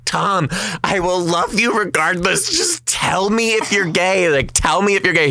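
high, pleading mother's voice; emotional mother. Retold or imitated. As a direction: beg him like an overwrought mom.

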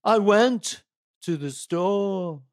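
high questioning Liverpool lilt, last word stretched; Beatle accent. Shown as a demonstration. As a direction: answer like a Beatle who always seems to be asking. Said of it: "always sound like you're asking a question".

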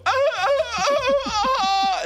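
very high, drawn-out yelps; imitated dog yelping. Wife overhearing the hurt dog, imitated high yelping. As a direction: wail like a hurt little dog, loud and high. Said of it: "they just heard him go".

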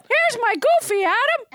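high, fast cartoon voice; a quick goofy cartoon voice. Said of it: "Do, do a nice Goofy".

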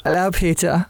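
put-on British greeting; British accent. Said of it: "say hello to you in a British accent".

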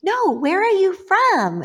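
high, mocking schoolkid voice; snotty kid pressing the question; mocking classmate.

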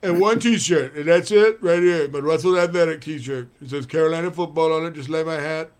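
put-on loud lisping old coach voice; fired-up Lou Holtz rant; Lou Holtz impression. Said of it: "Lou Holtz is here with a hair lip".

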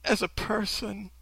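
imitated gushing sincerity, softened; mock sincere. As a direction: coo it with put-on sincerity. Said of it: "that was so sincere and enthusiastic".

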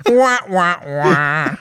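high, falling "wah wah" wail; mock wailing. Host teasing co-host over the Monster argument, mock wailing. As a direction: fake crybaby wail, starting high and dropping. Mocks co-host's appeal to Miguel.

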